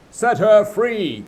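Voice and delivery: slow, loud, high captain's command; Hook's order. Acted. Peter as Hook ordering the pirates, slow command. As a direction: give the order flatly, slowly. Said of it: "came the astonishing answer".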